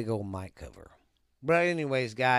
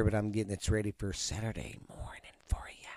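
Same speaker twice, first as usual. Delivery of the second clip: whispered aside; 2 semitones lower, 7 dB quieter, faster than usual. Real, not acted. Aside, unvoiced.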